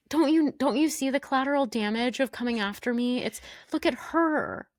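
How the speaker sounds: high whiny mock, slowing on look at her; mock arrogant Johnny. Podcaster imitating Johnny's self-pity, high and whiny. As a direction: sneer it like a smug reality star. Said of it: "just this whole, like".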